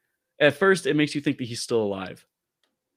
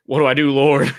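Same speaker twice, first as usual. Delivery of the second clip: mock panic; 2 semitones higher, 9 dB louder than usual. Hamming up the panicked man.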